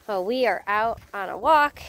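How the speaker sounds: normal talking voice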